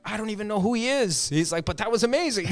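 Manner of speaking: high-pitched imitation of amazement; amazed bystander. Bystander reacts after wrestler leaves, mock amazement. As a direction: pitch it up, baffled but impressed.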